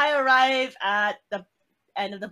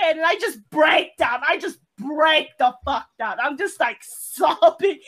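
hammed-up breakdown; 4 semitones higher, 6 dB louder than usual. Retelling breaking down before the manager, hammed-up. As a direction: exaggerate your own meltdown for comedy. Dramatizes her breakdown, laughing at herself.

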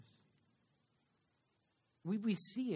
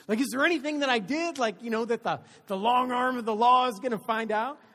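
louder, higher anxious rush; mock nervous worry; 7 semitones higher, 13 dB louder than usual. Driver fretting about what the police will find, loud anxious rush. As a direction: let the worry spill out. Retold nervous inner thought.

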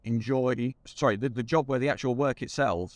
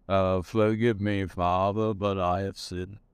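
slow, heartfelt low confession; with feeling; 4 semitones lower, slower than usual. Shown as a demonstration. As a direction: plead it slowly, with real feeling. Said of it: "With feeling".

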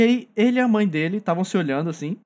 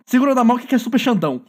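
louder, higher meme call; meme shout; 5 semitones higher, 4 dB louder, faster than usual. Retold or imitated. Friend shouts a meme to the sleepy girl, louder. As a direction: belt the meme line out.

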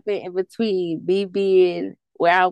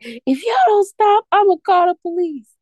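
yelling grandma; 11 semitones higher, 6 dB louder than usual. Retold or imitated. Grandma yelling at the siblings to stop fighting, high and loud. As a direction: shout it like an old grandma breaking up a fight. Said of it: "my grandma was like".

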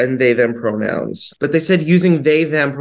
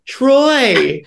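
mock call; 11 semitones higher, 4 dB louder than usual. Imagined recast calling out Troy.

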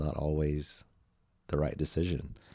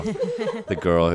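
laughing; 3 semitones higher, 9 dB louder, much slower than usual. Real, not acted. Laughing while recalling Danielle.

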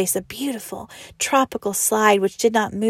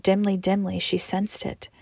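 plain reading voice

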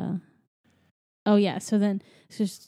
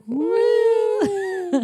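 high, loud ghostly "woo"; long high mock-ghost whoop; imitated escaping souls; 12 semitones higher, 8 dB louder than usual. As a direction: wail it like souls flying out. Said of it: "you hear the souls escape".

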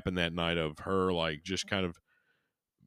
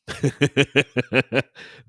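high, loud burst of laughter; real cackling fit, rapid and high; 6 semitones higher, 7 dB louder than usual. Real, not acted. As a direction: crack up out loud. Amused at what he just read.

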